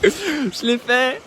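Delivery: high, slow triumphant laugh-talk; laughing with relief; relieved laugh. Real, not acted. Relief after the jump.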